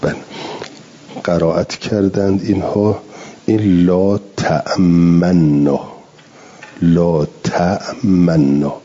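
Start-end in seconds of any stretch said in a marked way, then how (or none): 3.5-8.7 لا تأمنا with the nun doubled and held, slow. Shown as a demonstration. Teacher showing students the reading without ishmam, doubled nun. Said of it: "مشددتا نون".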